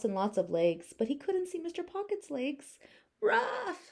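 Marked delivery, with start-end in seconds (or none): 3.2-3.9 high lonely yap; a small high bark; lost little dog bark. Acted. Lost Biff calling out, high bark. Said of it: "said Biff".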